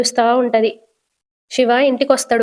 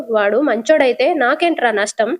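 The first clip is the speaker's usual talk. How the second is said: ordinary delivery, nothing marked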